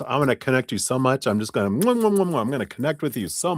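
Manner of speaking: high, fast, gushing clingy voice; mock anxious lover gushing with kisses. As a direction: smother them with eager affection, high and quick. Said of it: "it wants".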